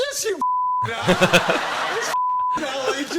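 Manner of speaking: very high, overexcited local; hammed-up squawking insistence; insistent pub bloke. Pub bloke loudly outing Ali G, high excited imitation.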